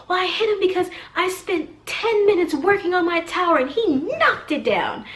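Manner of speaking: high-pitched imitation of an aggrieved kid; mock a child loudly protesting; indignant little boy. Little boy explains the knocked-down tower to a mom, indignant.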